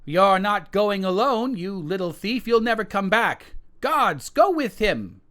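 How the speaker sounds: high, loud furious queen giving orders; queen sends guards after the son, incensed. Acted. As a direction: bark orders at the guards in a rage.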